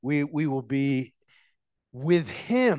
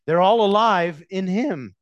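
ordinary talk, nothing marked

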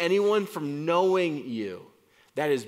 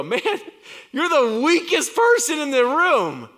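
louder, higher mock jeer; a mocking onlooker, louder and higher; mocking critic; 10 semitones higher, 7 dB louder than usual. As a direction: sneer like a heckler. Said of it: "you might look at me and go".

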